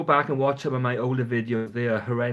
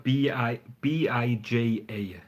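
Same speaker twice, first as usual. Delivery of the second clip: slow letter by letter spelling; slower than usual. Shown as a demonstration. Vlogger spelling the oil name for viewers, slowly. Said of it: "It's called".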